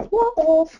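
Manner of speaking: very high, squeaky parrot "What?"; parrot squawk. Retold or imitated. Retelling the parrot's reply, high squeaky parrot call. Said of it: "And then she'd go".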